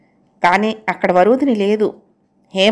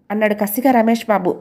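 ordinary delivery, nothing marked

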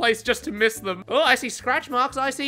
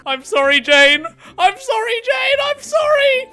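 apology climbing higher each time; teasing a survivor with sorries that rise in pitch; mock apology; 10 semitones higher than usual. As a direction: keep repeating sorry, pitch rising to a laughing squeak.

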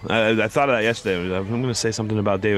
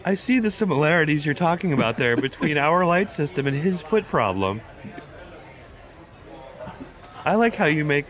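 put-on high puppet-like boy's voice; pitched-up Kermit-ish schoolboy; 6 semitones higher than usual. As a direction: drift the teen boy toward Kermit. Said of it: "you're just talking like a Kermit or something".

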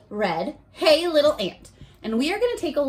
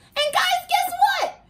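excited announcement; slower than usual. Real, not acted. Teacher announces fancy day excitedly.

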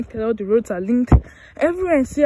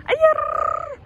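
high-pitched playful squeal; high drawn-out exclamation; odd yelp; 3 dB louder than usual. Real, not acted. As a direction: let out a sudden high, silly cry. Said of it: "What the fuck was that?".

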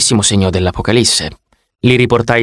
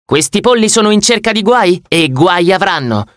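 heroic one-liner; 7 semitones higher than usual. Acted. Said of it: "la mia peggior battuta eroica".

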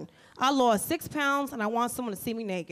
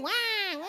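mock baby wail, high; baby crying; 6 semitones higher than usual. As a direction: imitate a screaming baby's wah wah. Mimicking a screaming baby in a tent.

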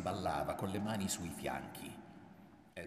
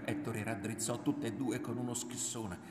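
usual speaking voice

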